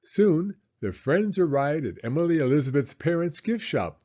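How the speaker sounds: usual speaking voice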